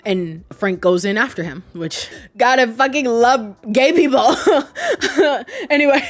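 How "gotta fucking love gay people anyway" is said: giggling high through words; laughing. Real, not acted. Laughing aside.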